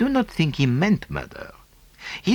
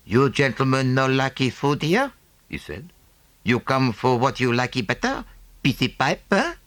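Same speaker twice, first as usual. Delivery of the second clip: a lightly put-on broken-English voice; sly innkeeper offering a pipe, lightly voiced; pidgin-speaking proprietor; 2 semitones higher than usual. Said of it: "the proprietor, a Chinaman".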